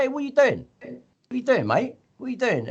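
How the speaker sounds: man outside a club yelling at a stranger tampering with his car; alarmed challenge. Retold or imitated. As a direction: confront the stranger messing with your car keys. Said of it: "I'm like".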